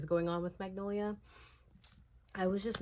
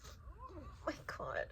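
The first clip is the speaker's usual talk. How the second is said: slow, breathless whisper of disbelief; oh my God breathed out in shock; 8 semitones higher, 7 dB quieter, slower than usual. Real, not acted. Reacting in disbelief to book.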